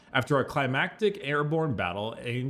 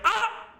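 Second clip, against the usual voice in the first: death cry. Retold or imitated. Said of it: "Angron's like".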